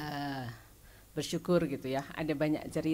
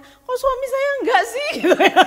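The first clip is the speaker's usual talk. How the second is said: high laughing burst, louder; 13 dB louder than usual. Real, not acted. Sharing how she doubted her husband, laughing loudly. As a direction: laugh at herself mid-story.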